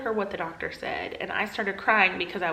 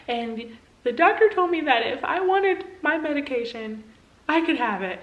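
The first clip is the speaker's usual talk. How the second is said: crying; 7 semitones higher, 4 dB louder than usual. Retold or imitated. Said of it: "I started crying, and I said".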